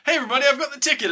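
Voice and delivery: high, rapid announcement; mock excited child. Charlie runs in to tell the family, rushed excitement. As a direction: burst in with big news.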